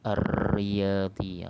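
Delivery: "Ar-Riyadi" slow and loud with a long trilled R; straight into ra. Shown as a demonstration. Teacher modelling shaddah, "Ar-Riyadi" with held R. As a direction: stretch the doubled R of "Ar-Riyadi". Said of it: "Sebut bu-, ra tu ya".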